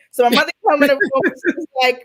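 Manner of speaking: ordinary talk, nothing marked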